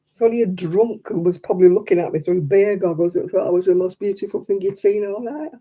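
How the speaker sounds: ordinary talk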